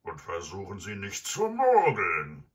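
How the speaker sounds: loud, gruff villain threat; robber's warning. Acted. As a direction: bellow the last warning, swelling at the end.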